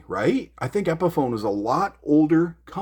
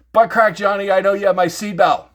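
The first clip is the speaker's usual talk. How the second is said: mock call-out; 6 semitones higher, 7 dB louder than usual. Calling out his enemy directly.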